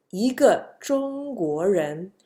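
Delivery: slow 一个中国人, 一 rising lightly; rising second-tone 一. Shown as a demonstration. Teacher demonstrates the rule, 一 in rising second tone. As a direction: lift 一 upward before 个 in 一个中国人. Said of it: "E become a light rising second tone".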